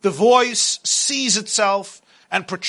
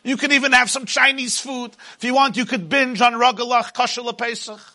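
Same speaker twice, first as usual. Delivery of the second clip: booming motivational speech; 4 semitones higher than usual. Acted. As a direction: preach it as the taunting tyrant. Pharaoh voice held, preaching.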